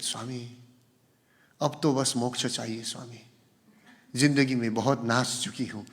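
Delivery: soft, melodramatic film-dialogue plea to the guru; filmy devotional plea. Retold or imitated. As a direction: plead softly like a film heroine. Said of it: "बहुत अच्छा dialogue है।".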